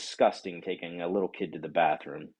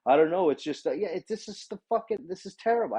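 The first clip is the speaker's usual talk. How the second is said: mock waitress; 10 semitones higher, faster than usual. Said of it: "I didn't say fucking".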